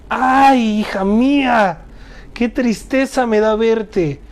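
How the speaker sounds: a high, slow cry of grief; grieving cry. A narrator's reading. Father grieving as daughter greets him, slow anguished cry. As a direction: lament the daughter slowly, in anguish. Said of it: "se llenó de tristeza y amargura".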